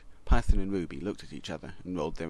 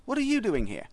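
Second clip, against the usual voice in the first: indignant surprise; 4 semitones higher, 7 dB louder than usual. A narrator's reading. C++ confronts XML.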